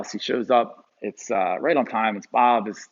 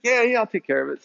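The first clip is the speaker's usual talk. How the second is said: lightly voiced, higher, breezy reassurance; easygoing plumber; 11 semitones higher than usual. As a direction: toss it off like it's no big deal. Voicing Bob, the old plumber.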